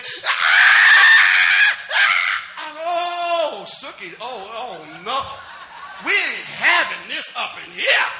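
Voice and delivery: high, loud shrieking mock of an angry girl; a hammed-up screaming daughter throwing a fit; daughter's mad scream. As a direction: shriek it out like a furious teenage girl. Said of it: "Girls got this screaming thing".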